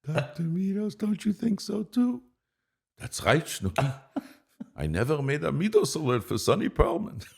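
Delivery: high, squeaky puppet voice way above his own; Snooki Sabero voice. Acted. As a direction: pitch it way up like a cartoon sidekick. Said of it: "could you say something in Snooki Sabero's voice".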